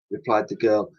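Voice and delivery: ordinary delivery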